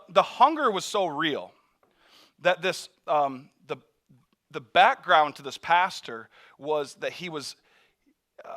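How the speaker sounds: normal talking voice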